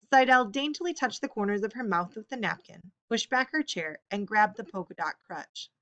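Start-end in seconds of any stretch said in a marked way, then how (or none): none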